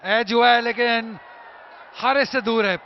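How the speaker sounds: loud live play-by-play; excited cricket commentary. Real, not acted. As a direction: call each boundary with a shout. Shots called live. (उत्साहित cricket commentary)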